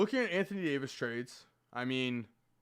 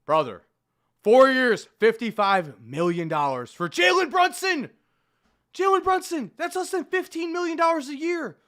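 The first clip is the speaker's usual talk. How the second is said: much higher and louder, disbelieving; incredulous outburst; 8 semitones higher, 8 dB louder than usual. Real, not acted. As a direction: exclaim in loud disbelief. Outraged at Brunson's cheap contract.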